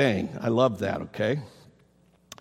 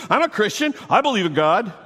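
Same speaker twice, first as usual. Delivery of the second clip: self-assured believer; 6 semitones higher, 4 dB louder, faster than usual. Acted. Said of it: "the next time somebody says to you".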